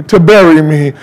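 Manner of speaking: normal talking voice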